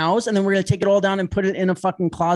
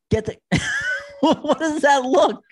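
laughing; 7 semitones higher than usual. Real, not acted. Host reacting to a co-host's look, laughing hard. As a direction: crack up while asking, voice pitched up.

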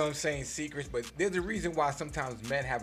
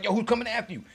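higher, louder retold alarm; imitating alarmed friend; 8 semitones higher, 6 dB louder, faster than usual. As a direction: act out a friend rushing to back you up. Homie runs out alarmed, retold.

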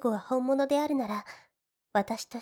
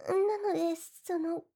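higher, trailing off into a shy whisper; fading to a whisper; 4 semitones higher, 4 dB quieter than usual. Acted. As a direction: lose your nerve word by word. Heroine shyly asks for kiss. (恥ずかしさで声が消え入る)

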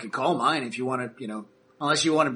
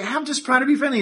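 much higher, whiny protest; new guy protests to Terrence, whiny and high; defensive new guy; 11 semitones higher, 4 dB louder than usual. Acted. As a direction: plead innocent, higher. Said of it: "he's just like".

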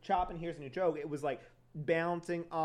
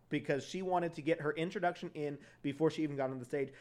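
ordinary talk, nothing marked

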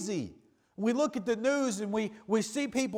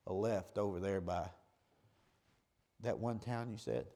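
mock nodding along; 11 semitones lower, 11 dB quieter than usual. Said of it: "pretending to everybody else like".

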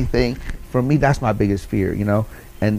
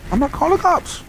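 pitched way up, mock-indignant; retold indignant refusal. Answering his wife's threat to call the cops, mock-indignant high refusal. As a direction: toss it back high and incredulous.

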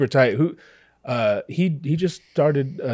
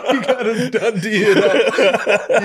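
slow, high, cracking up; tickled, high-pitched laughter; laughing; 7 semitones higher, 4 dB louder, slower than usual. Real, not acted. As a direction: laugh helplessly through the words. Imitating the Aussie exaggeration, laughing.

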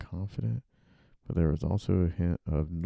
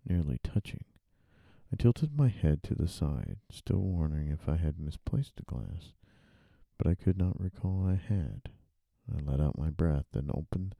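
normal talking voice

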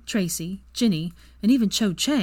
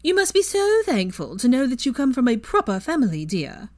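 higher, louder, syrupy mock politeness; sugary sweet fake voice; 6 semitones higher, 4 dB louder than usual. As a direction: purr it out with fake sweetness, meant to needle. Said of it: "her sugary sweet fake sissy voice".

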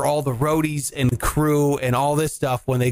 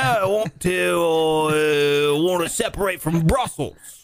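[name]. Retold or imitated. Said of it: "what fucking accent I'm doing".

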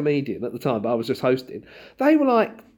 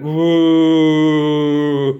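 long, loud sustained "woo"; crowd's woo; 2 semitones higher, 9 dB louder than usual. Retold or imitated. Comic mimicking the room's reaction to estate agents, long loud woo. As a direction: imitate a whole room going woo, loud and held. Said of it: "the whole room went".

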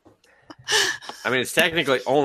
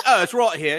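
higher-pitched mock British reply; bad British accent; 7 semitones higher, 3 dB louder than usual. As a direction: answer in a put-on British accent. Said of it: "pad British accent".

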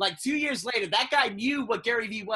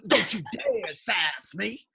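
slow, stretched mock scolding; much slower than usual. Shown as a demonstration. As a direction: draw out DARE and SASS, indignant.

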